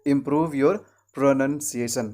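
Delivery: broken model phrase, "pronunciation" with short "nun"; correct pronunciation. Shown as a demonstration. Teacher repeats the example for learners, careful correct pronunciation. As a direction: pause before "pronunciation", then say it with nun not noun. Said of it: "क्या कहना है?".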